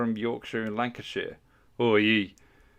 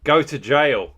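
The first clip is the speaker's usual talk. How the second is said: announcing; 4 semitones higher, 10 dB louder, slower than usual. Real, not acted. Reading wheel result aloud.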